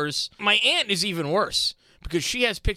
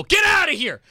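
yelled command, higher and louder; mock shout at dog; 11 semitones higher, 6 dB louder than usual. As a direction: bark it at the dog to get out. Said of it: "You go".

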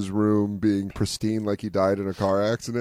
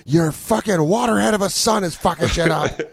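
calling out angrily; 8 semitones higher than usual. Acted. Father complaining to Rita about their son. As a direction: shout across the house at Rita. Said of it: "calls to Rita".

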